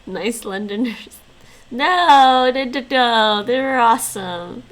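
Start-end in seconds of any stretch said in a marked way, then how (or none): 1.7-4.6 loud laughing protest. Real, not acted.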